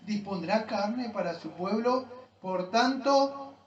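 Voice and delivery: loud, forceful reading that builds in weight; preaching scripture reading. Real, not acted. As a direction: proclaim the psalm, stretching key phrases as it intensifies. (lectura predicada con fuerza creciente)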